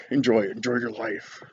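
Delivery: normal talking voice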